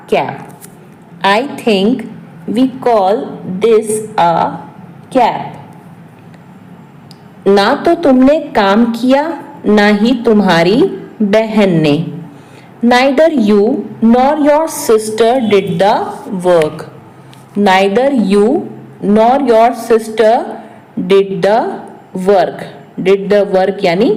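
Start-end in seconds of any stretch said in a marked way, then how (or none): 1.3-5.6 short pieces with long pauses; piece-by-piece repeat. Shown as a demonstration. 17.7-22.7 slow, with a pause after each phrase; phrase-by-phrase repeat. Shown as a demonstration. Repeats translation in short pieces.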